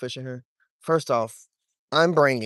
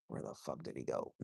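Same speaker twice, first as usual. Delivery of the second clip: hushed; 17 dB quieter than usual. Real, not acted. Hushed while stalking fish.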